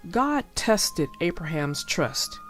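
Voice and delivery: ordinary delivery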